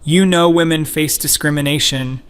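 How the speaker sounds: meltdown. A narrator's reading. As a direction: lose it in a heated rant. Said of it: "Grace has complete meltdown".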